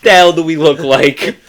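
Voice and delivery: tough-guy Brooklyn voice; Brooklyn accent. Acted. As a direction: lean into a Brooklyn wiseguy accent. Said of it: "You're like a Brooklyn accent".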